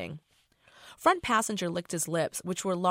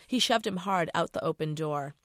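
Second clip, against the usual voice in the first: plain reading voice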